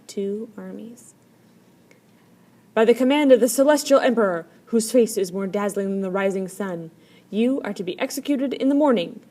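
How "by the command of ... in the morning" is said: loud, fast official proclamation; proclaiming. A narrator's reading. Generals proclaim the emperor's sentence to third brother, loudly. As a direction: announce the sentence with pomp. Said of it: "proclaimed the generals".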